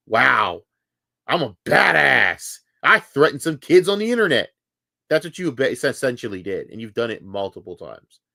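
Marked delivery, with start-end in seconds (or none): 0.1-4.5 lightly voiced smug fake tough guy, a touch louder; mocking fake tough guy. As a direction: sneer it like a wannabe badass who is proud of himself. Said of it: "look at yourself in the mirror and go".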